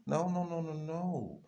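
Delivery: usual speaking voice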